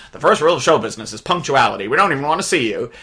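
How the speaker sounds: lightly acted fussy bandmate scolding; Beau Bridges. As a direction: rattle off a rule like a stickler. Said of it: "Beau Bridges slash Brian is like".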